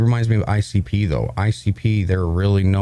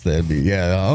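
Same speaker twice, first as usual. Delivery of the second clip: ordinary delivery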